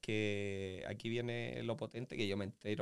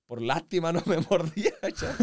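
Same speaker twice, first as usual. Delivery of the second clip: loud, higher, breaking into a laugh; 6 semitones higher, 9 dB louder than usual. Real, not acted. Telling the host about the dog, laughing loudly. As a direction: laugh through the words. Laughing at his own fall story.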